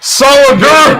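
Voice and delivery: high, loud drunken mimic of solidarity; mocking slur. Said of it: "when he's like, "I'll do it in solidarity".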